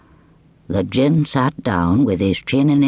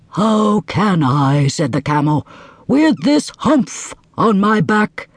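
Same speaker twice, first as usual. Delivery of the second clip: slow, sulky camel; whining camel; 6 semitones higher, slower than usual. Acted. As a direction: complain slowly. Camel complains.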